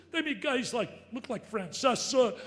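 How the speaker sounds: usual speaking voice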